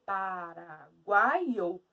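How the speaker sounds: very slow "paraguaio", the final o set apart; syllables separated. Shown as a demonstration. Teacher showing the split in paraguaio, syllables separated. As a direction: stretch "paraguaio" out, "guai" together then a separate "o". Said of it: "o O é separado do tritongo".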